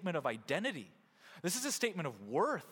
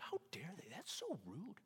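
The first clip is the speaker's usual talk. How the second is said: mock indignation; 12 dB quieter than usual. Voicing someone who got no thank you, mock indignation. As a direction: play offended under your breath. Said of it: "And you're like".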